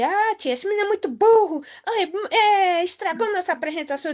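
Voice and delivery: complaining pupil. Retold or imitated. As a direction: mimic a child tattling to the teacher. Imitates pupils complaining about a classmate.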